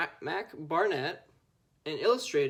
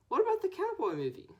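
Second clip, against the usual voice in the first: put-on high, fast complaining kid voice; a kid whining for the movie; whiny kid; faster than usual. A classmate whining about the cowboy movie, high and fast. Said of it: "says someone else".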